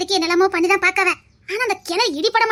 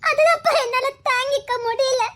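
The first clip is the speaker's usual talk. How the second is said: higher voice, crying through the words; tearful reproach; 5 semitones higher than usual. Acted. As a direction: sob out the hurt at father. Daughter reproaches absent father, crying.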